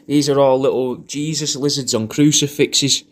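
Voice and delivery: ordinary talk, nothing marked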